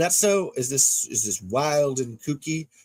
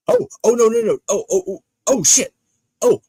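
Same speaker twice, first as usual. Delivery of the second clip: sudden high, loud alarmed oh no; shocked; 9 semitones higher, 8 dB louder than usual. Real, not acted. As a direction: yelp in real surprise. Sudden shocked realization on stream.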